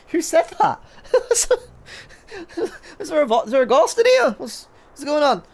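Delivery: high, laughing pretend fright; mock alarm. Pretends a stray voice startled them.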